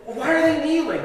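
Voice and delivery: put-on high, laughing kid's question; mock child voice, laughing; puzzled kid. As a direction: squeak it like a baffled boy. Kid voiced asking in church.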